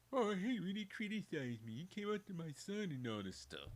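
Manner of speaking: high, whiny mock complaint; mocking LeBron as victim. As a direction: play the wounded crybaby. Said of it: "Playing the victim card".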